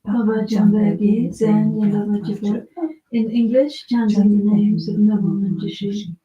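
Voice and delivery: steady, sonorous liturgical reading; scripture recitation. Acted. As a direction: read the sacred text with devotion. Reads the Manjushri scripture aloud.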